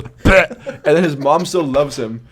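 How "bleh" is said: short, loud "bleh" sound effect; sudden silly "bleh"; mock blurted noise. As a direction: spit out a comic burst of noise. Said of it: "and he goes".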